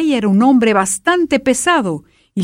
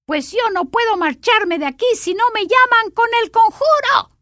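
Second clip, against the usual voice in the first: high, squeaky sprite voice; grumpy little creature; 13 semitones higher than usual. A narrator's reading. Little dream-eater scolding the king, squeaky and grumpy. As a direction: talk down to humans like a cranky imp.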